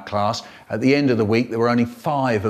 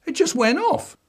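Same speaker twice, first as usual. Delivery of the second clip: put-on high whiny kid voice; boy pleading innocence to his dad; child voice. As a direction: squeak it out like a guilty little boy. Child's catchphrase repeated.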